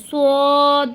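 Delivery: সদ thick and hard, lips rounded; heavy Arabic sad. Shown as a demonstration. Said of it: "একটু মোটা এবং একটু শক্ত করে".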